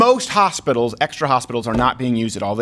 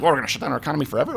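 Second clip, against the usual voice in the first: fast, softer scoffing; mock European; 4 semitones lower, 5 dB quieter, much faster than usual. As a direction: scoff at the idea. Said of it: "And they're like".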